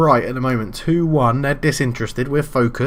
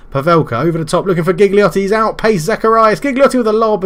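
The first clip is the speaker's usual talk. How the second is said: rising, louder and higher as the chance builds; excited commentary; 6 semitones higher, 5 dB louder than usual. Real, not acted. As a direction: call the breakaway with mounting excitement. Live commentary on a breakaway.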